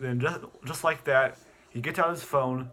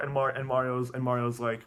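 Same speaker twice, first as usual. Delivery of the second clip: ordinary delivery, nothing marked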